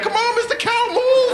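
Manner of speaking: very high mock kid's cry; high-pitched, urgent child impersonation; pleading schoolkid. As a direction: squeal it like a kid stuck behind a cow. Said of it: "The kid's gonna be like".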